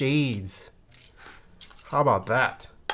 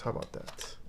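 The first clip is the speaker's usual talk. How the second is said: whisper; 13 dB quieter than usual. Real, not acted. Musing to self over keycap choice.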